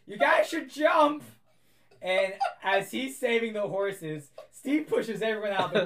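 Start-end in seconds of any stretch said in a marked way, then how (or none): 0.1-1.2 loud high slow shout to jump; shouted warning. Retold or imitated. GM voicing Philip warning the party, loud shout. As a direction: yell the warning across the carriage. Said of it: "you hear Philip goes".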